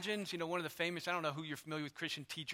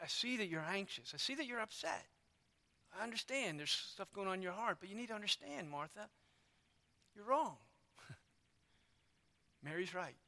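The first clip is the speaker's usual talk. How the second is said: softer tender correction; gentle rebuke; 7 dB quieter than usual. Retold or imitated. Jesus gently corrects Martha, soft and tender. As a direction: soothe her while correcting.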